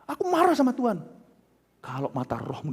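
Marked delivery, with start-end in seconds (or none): 0.1-1.0 high, louder mock outburst; angry complaint. Preacher voices a believer angry with God, acted anger. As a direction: voice an indignant believer's protest. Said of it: "Anda marah-marah".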